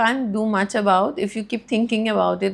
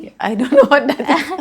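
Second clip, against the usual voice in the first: laughing, louder and higher; 6 semitones higher, 7 dB louder than usual. Real, not acted. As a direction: say it through a laugh. Amused surprise at new term.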